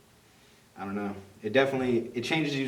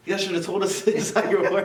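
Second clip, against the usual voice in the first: laughing complaint; 8 semitones higher, 5 dB louder than usual. Retold or imitated. Joking complaint to nurses.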